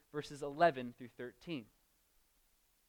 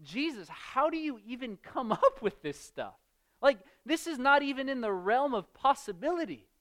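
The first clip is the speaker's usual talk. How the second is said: high, disbelieving protest; listening fathers scoffing at Jesus in disbelief; incredulous fathers; 7 semitones higher, 4 dB louder than usual. Retold or imitated. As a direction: scoff at how absurd it is. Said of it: "fathers there ... were probably thinking".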